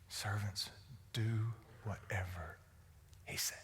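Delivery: very low, soft, slow instruction; slowly and softly, word by word; solemn imagined Mary. Acted. Mary instructs the servants to obey her son, slow and hushed. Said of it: "Mary says".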